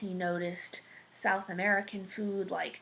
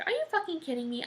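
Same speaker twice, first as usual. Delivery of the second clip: mock disbelief, voice jumping higher; incredulous retort; 10 semitones higher, much faster than usual. Jack told he should rule his country, incredulous. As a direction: throw it back in high-pitched disbelief.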